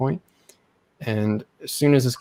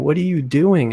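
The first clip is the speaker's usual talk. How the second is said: higher, louder imitated protest; mock alarm; 5 semitones higher, 6 dB louder than usual. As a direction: cry out as the kid under attack. Acting out kid being beaten.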